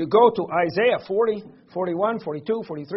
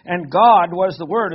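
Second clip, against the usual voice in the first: GOD drawn out and punched louder; stress on GOD; 6 dB louder than usual. Shown as a demonstration. Preacher showing the Greek word order to listeners, stress on God. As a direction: lean slowly and hard on the word God. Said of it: "an emphasis on that first word".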